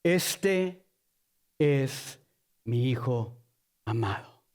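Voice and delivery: low, solemn voice of God, word by word; voice from the cloud. Acted. God speaks from the cloud, low and solemn. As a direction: drop deep and pause heavily between words. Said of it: "salió una voz que dijo".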